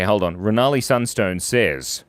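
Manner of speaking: ordinary talk, nothing marked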